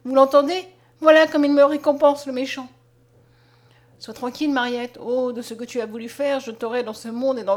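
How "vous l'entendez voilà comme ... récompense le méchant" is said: loud, wounded complaint; indignant cry. A narrator's reading. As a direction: call heaven to witness, loudly. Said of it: "s'écria Mariette".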